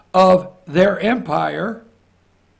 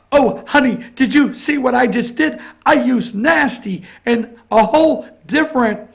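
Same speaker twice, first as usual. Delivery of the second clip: higher, hammed-up sugary husband voice; mock sweet husband; 5 semitones higher, 3 dB louder than usual. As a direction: gush it like a smitten husband, half mocking. Mocking husband to princess wife.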